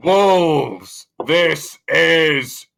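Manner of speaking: loud, booming god voice. Acted. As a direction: bellow it down from on high. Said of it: "I bellow down in my most kind of, like, booming voice".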